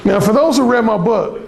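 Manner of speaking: ordinary talk, nothing marked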